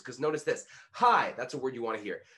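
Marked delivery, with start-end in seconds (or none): 0.9-1.3 "Hi" hit high and loud; emphasis on HI. Shown as a demonstration. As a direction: punch the word "Hi". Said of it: "practicing emphasizing keywords".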